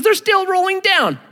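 put-on a small girl complaining plaintively; little girl whine. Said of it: "She goes".

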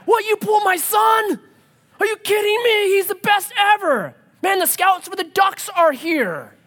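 high-pitched yelling from the sidelines; irate football dad. Acted. Dad at Pee Wee football yelling about his son, outraged. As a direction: holler in hammed-up parental outrage. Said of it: "just watch the dads".

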